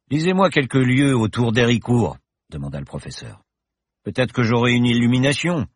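the confident pilot professor, merry; cheerful professor. Acted. Professor reassures Paloma in the plane, jaunty and loud. Bergton asks Paloma to read.

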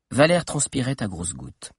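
plain reading voice

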